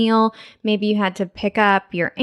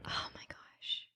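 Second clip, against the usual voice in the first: breathless whispered gasp; whispering; 24 dB quieter than usual. Real, not acted. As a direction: whisper it, overwhelmed. Overwhelmed by the compliment.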